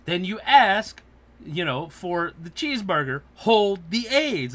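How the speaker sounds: loud and sassy, last words slow; sassy retort. Retold or imitated. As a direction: hold the aids dragged out slow. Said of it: "tells the motherfuckers, like".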